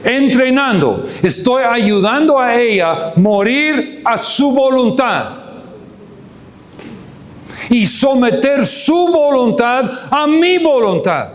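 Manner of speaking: high, impassioned sermon delivery; ringing declarations with falling endings; full-force preaching. Real, not acted. Preacher drives home his point.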